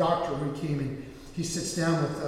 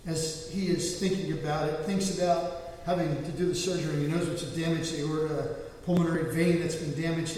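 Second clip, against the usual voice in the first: ordinary talk, nothing marked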